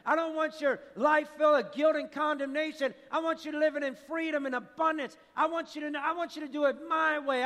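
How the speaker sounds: high-pitched, driving preacher delivery; impassioned preaching. Real, not acted. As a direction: preach it with rising urgency. Preaches at full force.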